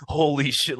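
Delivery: hyped commentator call, pitched high; imitating Rogan, rising. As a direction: mimic the commentator's awe as it peaks. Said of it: "Joe Rogan was going".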